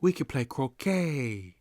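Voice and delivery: lightly done posh British "pip pip cheerio", a bit high; half-hearted upper-class English invitation; attempted British accent. Inviting a friend to croquet in a weak British accent. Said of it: "I can't do the right accent".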